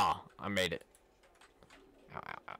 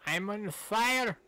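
mock alarm; 9 semitones higher, 3 dB louder, slower than usual. Character burning in game.

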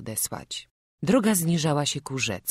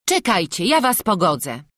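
higher, louder village woman settling a quarrel; Magda calling over; 5 semitones higher, 7 dB louder than usual. Acted. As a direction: step in and make peace, brightly. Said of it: "odezwała się Magda".

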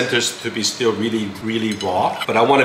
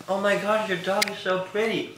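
gushing stranger; 9 semitones higher, 7 dB quieter than usual. Retold or imitated. Strangers gushing over the dog.

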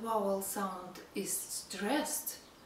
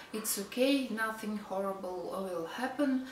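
normal talking voice

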